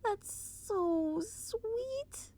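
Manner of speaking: high, slow whispered coo. A narrator's reading. Narrator cooing at the filly, slow high whisper. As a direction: breathe it out adoringly, very slow.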